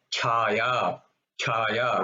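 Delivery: "Chaya" with extra emphasis and air on Ch; Mahaprana. Shown as a demonstration. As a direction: push breath out on the Ch of "Chaya". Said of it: "Mahaprana 'cause you're giving more emphasis".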